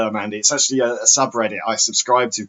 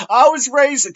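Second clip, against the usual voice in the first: much higher, louder whiny caller; imitating Lorne; 13 semitones higher, 6 dB louder, slower than usual. Retelling Lorne's call, high whiny mock voice. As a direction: whine it like a hurt man-child. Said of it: "'cause he's".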